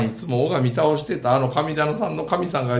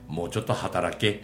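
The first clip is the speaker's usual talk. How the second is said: put-on lower, softer stern old spirit; lightly voiced poverty god; 10 semitones lower, 7 dB quieter than usual. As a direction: scold him to work, quietly. 貧乏神の台詞. (低く静かに叱る貧乏神)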